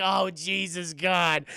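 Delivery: hammed-up miserable wail; loud, acted anguish; mock crying. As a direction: wail loudly like someone pitying himself. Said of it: "He cries so much".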